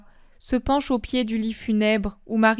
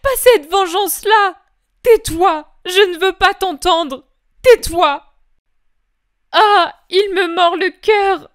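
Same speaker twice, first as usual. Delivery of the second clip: louder anguished cry at an unseen enemy; tearful shout of terror, mildly raised; 9 semitones higher, 8 dB louder than usual. Acted. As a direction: drive the vision back with a cry. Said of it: "Et elle crie".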